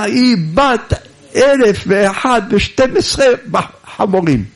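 high-pitched mock outrage; acted name-calling. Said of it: "וכל הלילה ממטירים צעקות". (עלבונות בכעס משוחק)

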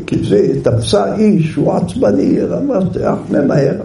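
ordinary talk

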